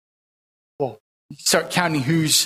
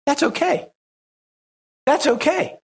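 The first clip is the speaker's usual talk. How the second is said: lifted, gentle reassurance, noticeably higher; reassuring; 8 semitones higher than usual. Retold or imitated. Preacher voicing John reassuring the crowd, gentle and higher. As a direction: soothe the worry, lightly up. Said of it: "he goes".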